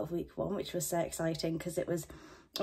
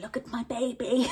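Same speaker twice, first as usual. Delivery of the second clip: annoying proud friend; 5 semitones higher than usual. Retold or imitated. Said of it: "I want to be like that annoying friend".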